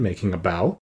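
ordinary delivery, nothing marked

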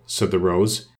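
ordinary delivery

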